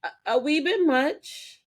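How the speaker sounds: high, mock soothing; cool her down gently; calming Prue. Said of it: "she's just like".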